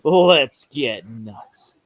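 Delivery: low, slow Adam West voice; drawn-out, campy hero delivery; Adam West impression. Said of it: "So imagine Adam West saying that".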